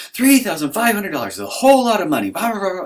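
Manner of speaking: high, loud, hammed-up outrage; shrieky mock tirade ending in blah-blahs; mock laying into him. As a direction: imitate a scolding wife at full pitch. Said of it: "kinda lays into me".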